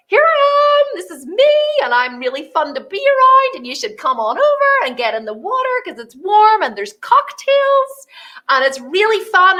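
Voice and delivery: high, bright-sung welcome; hammed-up party host inviting people in; bubbly invitation. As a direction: lure them in with fun. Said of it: "I'm just engaging and I go".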